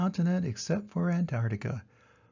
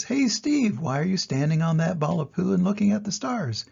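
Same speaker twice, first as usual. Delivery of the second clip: high call on the name; curious porcupine; 4 semitones higher than usual. Acted. Porcupine asks Steve about the stars, high. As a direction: call high, then wonder aloud. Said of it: "The crested porcupine says".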